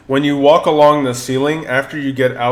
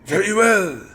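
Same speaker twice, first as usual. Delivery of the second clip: put-on higher French-accented very well; french chef; 8 semitones higher than usual. Candelabra answers.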